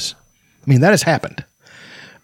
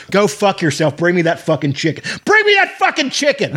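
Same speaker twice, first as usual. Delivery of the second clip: barked kitchen orders, last line leaping high; imitated chef yelling; 6 semitones higher than usual. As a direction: play a chef bossing the line, building.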